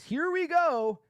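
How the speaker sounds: high, loud sneering read-out; mocking John's rant. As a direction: read his message like a whiny rival. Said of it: "He says".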